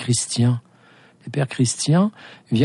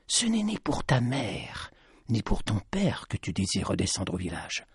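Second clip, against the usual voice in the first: a wise woman warning quietly; grave old lady; 2 semitones lower, 8 dB quieter than usual. A narrator's reading. The lady's voice.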